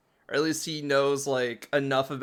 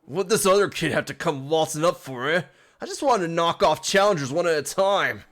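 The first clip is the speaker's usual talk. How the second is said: put-on louder grumbling British accent; 3 semitones higher, 5 dB louder than usual. Grunt annoyed at the extra kid, British accent. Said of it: "It's English, but with an accent".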